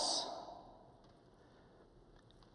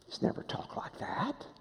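awed whisper; 4 semitones higher, 14 dB quieter than usual. Retold or imitated. Crowd reacting in awe.

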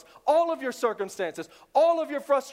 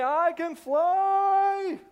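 mock boast; 8 semitones higher, 3 dB louder, much slower than usual. Mock boast of believer jumping.

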